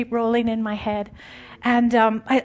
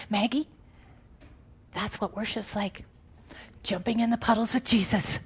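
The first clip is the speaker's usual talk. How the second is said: quiet, softer, tender explanation; gentle; 6 dB quieter than usual. Retold or imitated. Jesus gently telling Maggie what worship is, quiet and tender. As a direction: let it land softly, like a secret.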